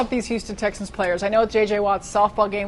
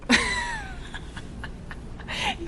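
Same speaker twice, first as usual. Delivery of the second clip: mock high-pitched squeal, far higher than his own voice; a hammed-up squeal of excitement over dessert; imitating a high squeal of delight; 6 dB quieter than usual. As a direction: squeal like a kid who just heard dessert. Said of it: "this is what Vandermeer does. He goes".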